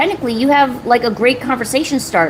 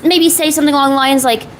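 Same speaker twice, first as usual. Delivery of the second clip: ordinary talk, nothing marked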